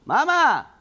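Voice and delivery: loud high call, voice carrying through the house. Real, not acted. Calling mum to come see the swarm, loud high shout.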